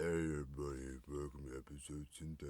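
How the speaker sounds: low mumbling Stallone intro, fast at the end; Sylvester Stallone impression. Host greeting listeners as Stallone, low and mumbled. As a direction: growl the welcome like Rocky. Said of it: "Sound like Sylvester Stallone".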